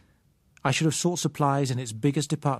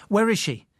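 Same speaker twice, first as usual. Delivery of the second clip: sharp, louder urgent question; demanding; 8 semitones higher, 5 dB louder than usual. Acted. Man demanding where the sighted girl is, urgent and louder. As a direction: press her hard for an answer. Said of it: "I demanded".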